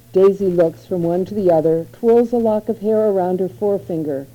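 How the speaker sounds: usual speaking voice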